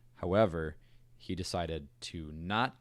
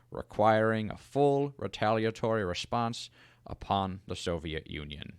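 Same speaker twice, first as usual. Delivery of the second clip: louder, formal presidential address voice; reading the Cuba statement as the president; Kennedy impression; 3 semitones higher, 6 dB louder than usual. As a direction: channel a stately Kennedy speech from the podium. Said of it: "I liked that one a lot. It was a lot less George W. Bush".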